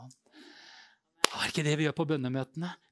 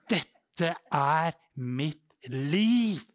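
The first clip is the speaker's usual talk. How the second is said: very slow, weighty delivery; drawn-out declaration; 3 dB louder, much slower than usual. Retold or imitated. Preacher declares his calling to the congregation, slowly and emphatically. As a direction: stretch each word out.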